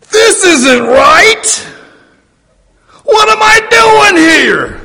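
high, loud, slow panicked cry; frightened wail from sinking Peter; panicked shout. Acted. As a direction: Peter yelling in terror as the waves rise.

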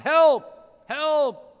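high, loud desperate cries for help; yelling for help. Retold or imitated. Stranded man hanging over a cliff, yelling loud for help. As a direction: shout up the cliff for rescue. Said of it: "he yells out".

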